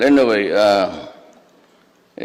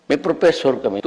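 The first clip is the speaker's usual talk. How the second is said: usual speaking voice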